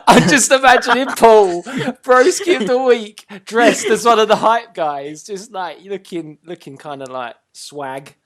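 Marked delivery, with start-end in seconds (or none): 0.1-5.2 loud, slow laughing tease. Real, not acted. Teasing a friend, laughing loudly.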